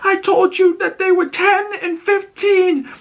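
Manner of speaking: very high, frail put-on old woman voice; a lost mother pleading, dazed; confused old mother.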